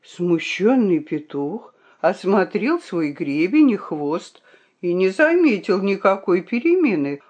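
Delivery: ordinary delivery, nothing marked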